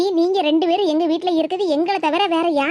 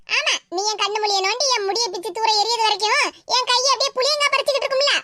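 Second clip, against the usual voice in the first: ordinary talk, nothing marked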